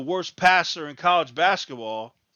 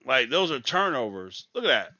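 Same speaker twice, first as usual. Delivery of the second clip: ordinary delivery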